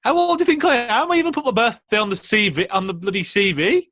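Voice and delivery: high, loud, outraged protest; indignant outburst. Retold or imitated. Retelling being turned down, high outraged protest. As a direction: squeal it like you can't believe it.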